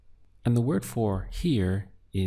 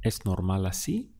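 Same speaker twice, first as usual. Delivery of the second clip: "Es normal así?" with a sharp rise on "así"; question intonation. Shown as a demonstration. Teacher models the question for learners, "Es normal así?" rising on "así". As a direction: ask it by lifting the end of "así". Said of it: "use the intonation of your voice".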